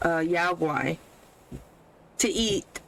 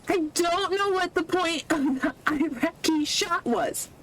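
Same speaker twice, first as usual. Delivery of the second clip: exasperated rant; 8 semitones higher than usual. Real, not acted. Ranting at the pointless opening.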